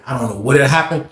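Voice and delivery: usual speaking voice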